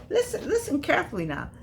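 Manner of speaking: high, laughing call to listen; laughing, high-pitched listen up; playful call for attention. Real, not acted. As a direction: pitch it way up, laughing, to grab the room. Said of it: "for the people in the back".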